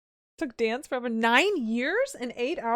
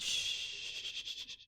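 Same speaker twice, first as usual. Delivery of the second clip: long, soft shush; sustained quiet shh; 9 dB quieter than usual. Real, not acted. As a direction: hush them with a drawn-out hiss. Shushes after accusing someone.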